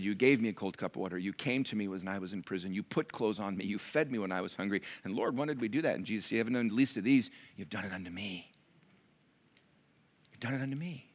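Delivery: quiet, reverent storytelling; hushed retelling. A narrator's reading. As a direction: draw them in with a hushed voice. Quiet retelling of Jesus' words.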